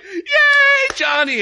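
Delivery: very high, loud cheer; overjoyed father shouting praise; cheering dad. Retold or imitated. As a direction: cheer for the baby's wobbly step. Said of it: "and Dad's like".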